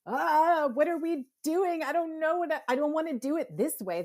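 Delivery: high, loud whiny protest; mock reluctant complaint. People balking at instructions, high and whiny. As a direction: whine like someone told to follow rules. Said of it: "people are like".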